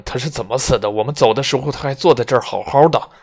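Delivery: impatient man demanding answers over a dead body. Acted. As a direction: push him hard, he's lost patience. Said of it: "语气显得很不耐烦".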